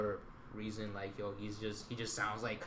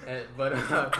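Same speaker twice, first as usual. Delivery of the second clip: being tickled; 2 semitones higher, 5 dB louder than usual. Real, not acted. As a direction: burst out laughing, squirming. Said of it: "Stop tickling [name]".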